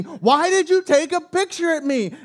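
hammed-up outraged shout at the driver; imitating her screaming again; retold scream. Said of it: "she's screaming at me".

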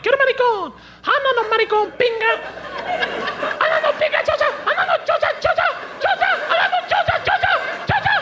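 put-on very high squeaky kid voices; kids squabbling in Spanish. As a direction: play bickering little kids. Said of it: "a Mexican mom chastise her children".

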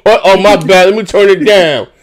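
ordinary delivery

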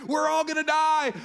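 high, very loud pulpit yell; imitated preacher at full shout. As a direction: bellow it like a worked-up preacher. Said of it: "one of those yelling preacher moments".

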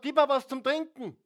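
high, loud thirsty plea; Jesus asking. Acted. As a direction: voice Jesus calling out for water. Jesus voiced in Bible story.

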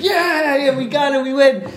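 high, excited cheer of victory; cheering boys. Retold or imitated. Yeshiva boys cheering at the screen, high and excited. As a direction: yelp it like the boys celebrating a win. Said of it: "Everybody's like".

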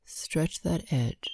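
slow, hushed breath counting. Acted.

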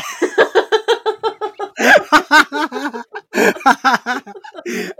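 big burst of laughter; loud high laugh. Real, not acted. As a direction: crack up loudly. Laughs after the punchline.

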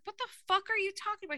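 high, fast exasperated disbelief; incredulous outburst. Retold or imitated. Retelling her reply to a friend's pizza text, high fast incredulity. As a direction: fire it off fast and high like you can't believe it. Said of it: "And I was like".

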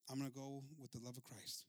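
soft, low, settled answer; quiet resolve. Acted. Widow refusing the soldiers' escort, quiet resolve. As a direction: state it softly and low, mind made up.

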